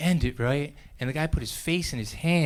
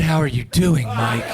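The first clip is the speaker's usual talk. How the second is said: DOING pushed loud, MIKE dropped low; more emphasis; 3 semitones lower, 4 dB louder, slower than usual. Acted. Friend asking Mike a second time, pointed emphasis. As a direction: repeat the question with a hard push on "doing". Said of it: "ask with more emphasis".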